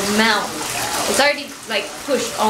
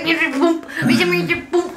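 high comic bumping noises; imitated toddler tumbles; 6 semitones higher, much slower than usual. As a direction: act out a clumsy toddler bonking along, high and light. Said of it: "Jackson was always just like".

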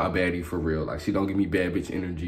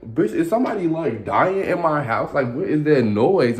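startled disbelief; 7 semitones higher, slower than usual. Retold or imitated. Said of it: "I'm listening, like".

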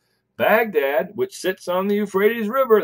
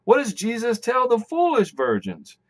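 ordinary talk

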